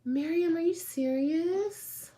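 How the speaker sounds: normal talking voice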